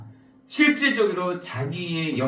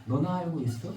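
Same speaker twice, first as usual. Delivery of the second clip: softer, hushed divine question; God asking softly; 5 semitones lower, 9 dB quieter than usual. Acted. God quietly asking the struggling believer, soft voice. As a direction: let God ask gently, almost under breath. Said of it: "라고 물어보시는 거죠".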